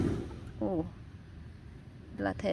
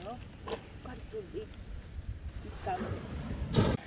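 whisper; 2 semitones lower, 13 dB quieter than usual. Real, not acted. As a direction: whisper so the fish won't hear. Hushed while the fish comes in.

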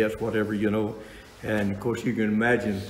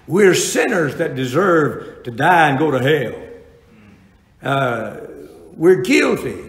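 preaching at full force; 3 semitones higher, 5 dB louder than usual. Acted. Preacher to congregation about justification, loud and slow. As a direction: hammer home God's work, slowly.

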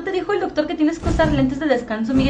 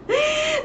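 shrieking laugh; 12 semitones higher, 3 dB quieter than usual. Real, not acted. Laughing burst at chat.

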